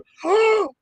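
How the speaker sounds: high, sharp theatrical gasp; hammed-up shocked gasp; mock gasp. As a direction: fake a huge dramatic gasp.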